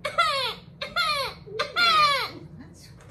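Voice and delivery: put-on high, wailing baby cry; mock baby wail; imitated baby cry. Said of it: "is like a baby crying".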